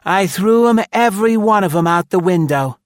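high, loud, gloating woman's voice; smug shrewish triumph; shrewish satisfaction. A narrator's reading. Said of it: "full of shrewish satisfaction".

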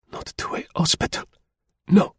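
breathless whisper, begging; faint pleading whisper. A narrator's reading. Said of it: "faintly but in the most earnest entreaty".

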